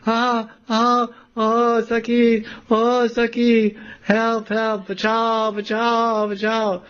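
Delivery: high, drawn-out cries for help; mock yelling for help. Radharani dangling from the branch, high mock yell for rescue. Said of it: "she's yelling like that".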